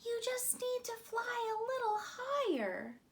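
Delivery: high, gentle urging; encouraging moon. A narrator's reading. The moon urging people on Earth upward, high and gentle. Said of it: "encouraged the moon".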